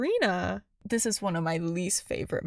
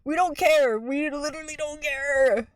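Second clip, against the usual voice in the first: exasperated; 5 semitones higher than usual. Real, not acted.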